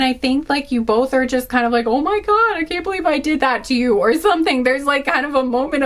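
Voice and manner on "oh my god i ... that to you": high, fast mock disbelief; shocked gasp. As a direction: voice their horrified surprise, hammed up. Imagined couple's shocked thoughts.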